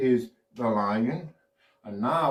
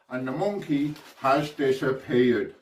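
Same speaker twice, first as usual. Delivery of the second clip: normal talking voice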